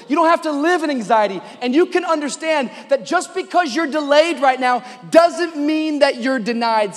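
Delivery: high, loud, insistent exhortation; full-force preaching. Real, not acted. Preacher encouraging people in a waiting season, full-force preaching. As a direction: drive each repeated line home.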